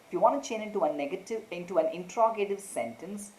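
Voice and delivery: normal talking voice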